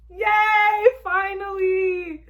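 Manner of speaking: high, loud cheer, finally drawn out; real joy. Real, not acted. Vlogger cheering at the love confession, overjoyed. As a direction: celebrate like the ship just sailed.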